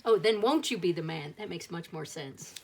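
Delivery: ordinary delivery, nothing marked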